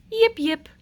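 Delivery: high, loud yapping puppy; puppy yip. Acted. Puppy greeting the prince, high yips. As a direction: yip like an eager little dog.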